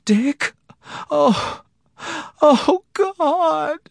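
very high, gasping sobs; sobbing helplessly. A narrator's reading. Wife reunited with her husband, sobbing helplessly. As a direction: wail it through helpless tears. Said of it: "sobbing helplessly".

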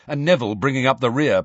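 ordinary delivery, nothing marked

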